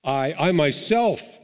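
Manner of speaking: "I, I myself" slow, punched on each word; emphatic I MYSELF. Shown as a demonstration. Preacher quoting John's emphatic verse to the congregation, stressed "I, I myself". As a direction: lean hard on "I, I myself", drawn out. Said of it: "is emphatic where it says".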